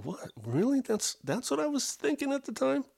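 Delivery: high incredulous disbelief; mock disbelief at my own thoughts. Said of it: "other stuff I'm going".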